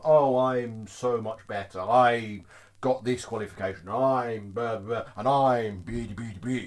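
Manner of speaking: low, slow, self-important drone; mock condescending. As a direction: talk down to them, mocking a show-off. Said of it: "you're speaking down to them".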